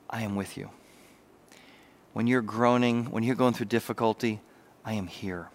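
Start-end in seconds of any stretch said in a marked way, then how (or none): 0.1-0.7 soft divine voice. Acted. God's voice speaking softly. 4.8-5.5 very soft, low God voice; whispered reassurance. Acted. God's voice speaking softly.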